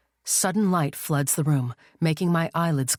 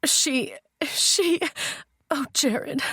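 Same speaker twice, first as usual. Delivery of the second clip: much higher, breaking voice near tears; 11 semitones higher, much slower than usual. A narrator's reading. Wife breaking down to Jared after the shooting, tearful. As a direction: choke out his name as she breaks down. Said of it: "I break down".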